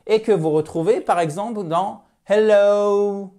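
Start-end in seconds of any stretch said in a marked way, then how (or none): 2.3-3.3 "hello" with a long gliding oh-oo ending; English diphthong. Shown as a demonstration. Teacher illustrating a double sound, drawn-out English hello. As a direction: draw out "hello", high and gliding to oo. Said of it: "voyez, c'est pas hello".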